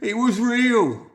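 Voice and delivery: high, loud, drawn-out wail; frightened man insisting he saw it. A narrator's reading. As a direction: cry it out in desperation. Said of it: "wailed Crabtree".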